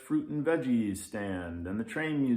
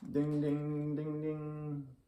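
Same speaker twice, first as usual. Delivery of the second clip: high sing-song ding ding; bell sound; 6 semitones higher, slower than usual. Acted. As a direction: chime it like a little bell. Bell chimes in narration.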